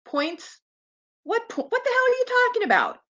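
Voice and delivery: the mechanic can't believe the question, snapping back quickly; incredulous mechanic. Retold or imitated. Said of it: "And the guy goes".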